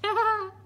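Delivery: loud high laugh; big laugh. Acted. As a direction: throw your head back and laugh. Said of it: "threw back her head and laughed".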